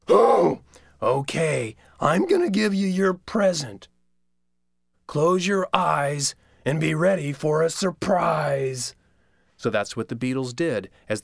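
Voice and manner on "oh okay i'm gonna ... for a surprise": high sly trickster; sly Coyote voice. Acted. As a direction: promise a sneaky surprise.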